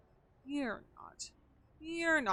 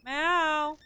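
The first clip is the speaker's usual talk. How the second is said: bright call across the room; calling the cat; 5 semitones higher, 6 dB louder than usual. Real, not acted. Calling out to her cat, loud and fond.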